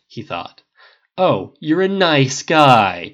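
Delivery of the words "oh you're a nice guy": loud, drawn-out mocking sneer. A narrator's reading. Tony mocking Harris's nice guy claim, sneering. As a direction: stretch it out with heavy sarcasm. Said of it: "Tony sneered".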